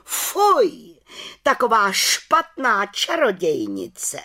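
high, slow scandalised shout; indignant outcry. A narrator's reading. Head witch scolding the council in indignant high outcry. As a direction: cry out in outrage, dragging the words. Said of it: "pohoršeně vykřikla".

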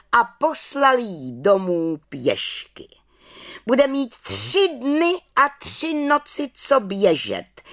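head witch calls out the sentence; head witch proclaims. Acted. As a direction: announce the punishment to the crowd. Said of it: "zvolala vrchní čarodějnice".

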